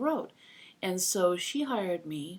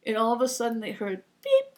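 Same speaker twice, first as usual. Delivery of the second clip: ordinary delivery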